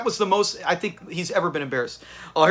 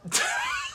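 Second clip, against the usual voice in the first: high laugh; 9 dB quieter than usual. Real, not acted. Laughing at Fred.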